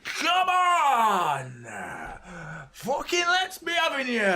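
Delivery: high, shouted footballer geeing everyone up; mock footballer rallying cry. Mocking players who shout at teammates, hammed-up rallying yell. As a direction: ham up a player screaming for effort. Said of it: "Everything they do is like".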